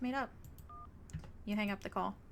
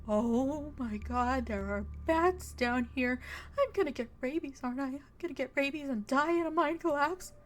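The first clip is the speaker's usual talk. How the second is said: panicky; 3 semitones higher, 3 dB louder than usual. Acted. Nervous friend spotting bats, panicking. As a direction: fret aloud about rabies.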